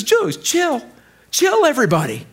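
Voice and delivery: casual, easy-going calm; laid-back calming. Retold or imitated. Jesus calming panicked disciples, laid-back. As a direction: tell them to relax, no worries. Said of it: "He looks at him and he says".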